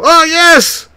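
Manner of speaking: loud, high joyful whoop; excited cheer. Real, not acted. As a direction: cheer the big play. Cheering a big hit in game.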